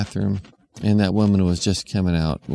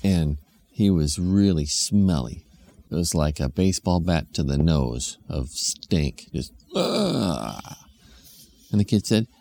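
usual speaking voice

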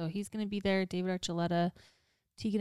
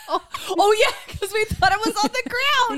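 high, loud, giddy shriek; worked-up, high-pitched and loud; real excited outburst; 9 dB louder than usual. Real, not acted. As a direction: squeal it out, reliving the scare. Excitedly reliving the snake scare.

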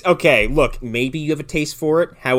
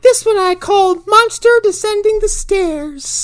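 very high, slow, loud mock mom; airy-headed artist in a drawn-out falsetto; spaced-out mom; 5 dB louder than usual. Mom presenting her metal sculpture, spaced-out falsetto. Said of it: "her mom sounds like she's fucked up on Quaaludes".

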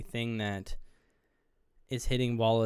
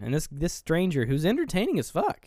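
usual speaking voice

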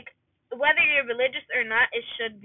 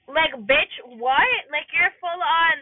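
louder, incredulous outburst; incredulous outrage; 3 semitones higher, 5 dB louder, much slower than usual. Real, not acted. Reacting to a cheater's confession, incredulous. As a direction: call out the cheater in disbelief.

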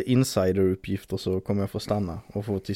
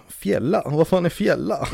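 high, puzzled outburst; baffled; 8 semitones higher than usual. Retold or imitated. Reacting to friends' slang, startled and high. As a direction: play it as a stunned question. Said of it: "Och jag bara".

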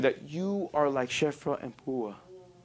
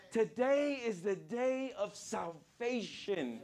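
soft, slow, drawn-out repeat; hushed proclamation; 6 semitones higher, 9 dB quieter, slower than usual. Real, not acted. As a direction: let it sink in, softly and slowly. Preacher repeats the key line softly.

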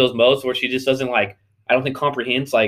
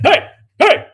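high-pitched, loud comic command; hammed-up high shouting impression; Kevin Hart; 14 semitones higher, 7 dB louder, much slower than usual. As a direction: squeal it out in short bursts. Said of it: "like, the Kevin Hart".

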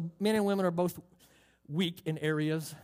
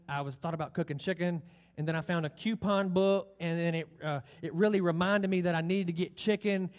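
rambling wife impression; 2 semitones higher than usual. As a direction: play the wife who talks in circles. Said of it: "Ladies don't talk like that".

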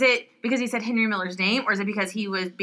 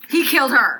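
"He killed her" pitched up and loud; emphatic accusation; 5 semitones higher, 7 dB louder than usual. Real, not acted. Listener insisting Olive's husband killed her, emphatic accusation.

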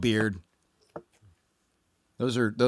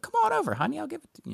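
much higher, sing-song doting mother; sweet mom voice; much faster than usual. Retold or imitated. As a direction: coo it like an eager, doting mom. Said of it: "that's the voice that I'm projecting on it for her".

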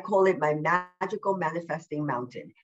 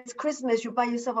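higher voice of a fretting mother; imitating worried mom; 6 semitones higher than usual. Mom pities her alone at Christmas, in a higher voice. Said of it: "my mom is like".

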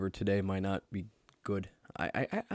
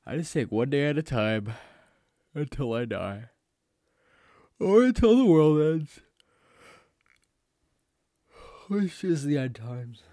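dramatic doom voice; 6 semitones higher, 8 dB louder than usual. Acted. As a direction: intone it like a doomsday prophet. Mock doom about world ending.

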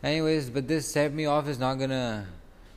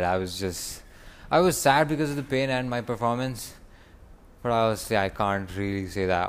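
normal talking voice

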